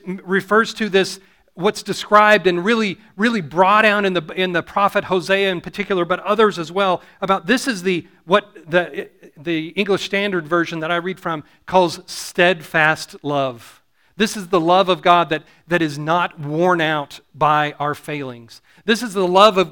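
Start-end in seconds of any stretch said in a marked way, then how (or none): none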